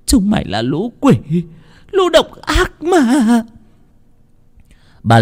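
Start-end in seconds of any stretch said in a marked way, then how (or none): 0.1-3.4 high, slow, crying out through tears; a grieving mother breaking into sobs; mother cursing her daughter's killers, sobbing; choked with sobs. Acted. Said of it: "Bà nghẹn ngào".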